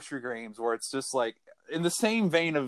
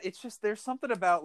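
ordinary delivery

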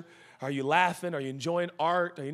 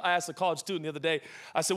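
usual speaking voice

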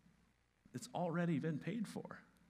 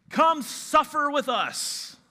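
high, slow, booming slogan shout; hammed-up loud invitation, drawn out; mock rallying cry; 13 semitones higher, 12 dB louder, slower than usual. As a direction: holler it like a comic recruiting pitch. Mock banner slogan called out.

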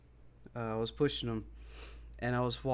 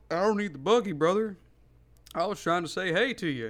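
higher, louder voice for a stranger; retold dog owner; 6 semitones higher, 5 dB louder than usual. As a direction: lightly voice the dude with the dog. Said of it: "and he's like".

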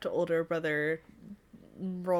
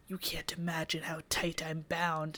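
quiet breathy whisper; whispered quote; 3 semitones lower, 9 dB quieter than usual. Retold or imitated. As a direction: hush it like a confession. Quoting the book character.

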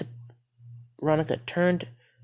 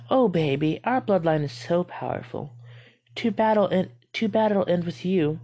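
higher, louder taunting mother voice; mock mother; 5 semitones higher, 3 dB louder than usual. A narrator's reading. Skinwalker as her mother taunting Veronica, sweet menace.

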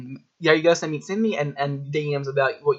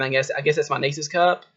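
ordinary delivery

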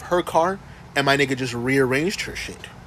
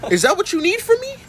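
high-pitched mock threat, voice climbing on the questions; playful confrontational rant, pitched way up; 10 semitones higher than usual. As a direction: challenge them in a hammed-up, high voice. Acts out threatening someone.

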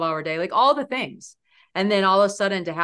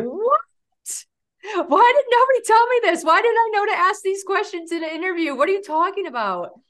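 much higher and louder, stunned outburst; shocked disbelief; 14 semitones higher, 6 dB louder than usual. Retold or imitated. New advisor learning she's in the call center, shocked. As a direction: blurt it out as the floor drops away.

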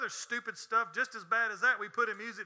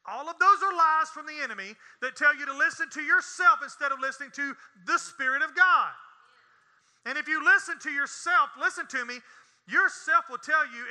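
louder, higher, emphatic preacher; full-force preaching; 5 semitones higher, 6 dB louder than usual. Real, not acted. Preacher warns church against listening to yourself, full-force preaching. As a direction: drive each phrase home forcefully.

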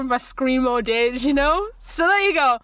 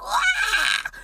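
high mock wail; child's wail. GM imitates crying kids.

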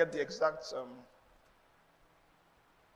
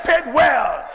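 higher and louder, a full shout; rally the whole room to shout it back; shouted call; 5 semitones higher, 11 dB louder than usual. Real, not acted. Calls congregation to repeat.

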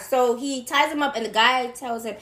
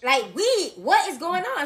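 ordinary delivery

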